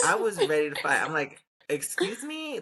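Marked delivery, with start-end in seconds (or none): 1.7-2.6 high, incredulous challenge; mock-offended, pitch shooting up; retold indignation. Storyteller retelling a retort to a seated concertgoer, indignant.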